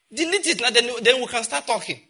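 high, loud and insistent; loud firm command. Retold or imitated. Counsellor ordering a man to delete his betting app, loud. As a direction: order him to delete it now.

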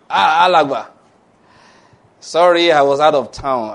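loud apologetic call, acted out; hearty debtor greeting. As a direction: hail a creditor loudly with a mock apology. Acts debtor hailing his creditor.

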